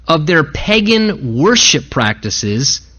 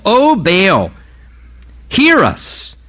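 a light mock wail of pleading prophets; crying out to Baal; 3 semitones higher, much slower than usual. Baal's prophets pleading with their god, mock wail. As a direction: call up to the sky, begging lightly. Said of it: "they're crying out for Baal".

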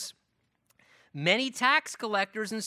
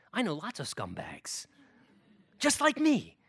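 whispering; 5 semitones lower than usual. Acted. Levi confides about his buddies.

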